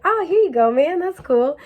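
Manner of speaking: breezy take-it-easy brush-off; chill hippie. Retold or imitated. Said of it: "They're like".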